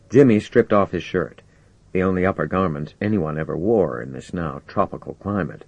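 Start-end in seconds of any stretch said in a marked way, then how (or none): none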